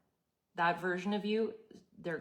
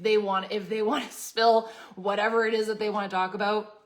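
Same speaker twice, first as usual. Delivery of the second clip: louder, laughing through the words; 3 semitones higher, 8 dB louder than usual. Real, not acted. Host telling viewers to let gossips talk, laughing. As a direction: let the laugh spill into the line.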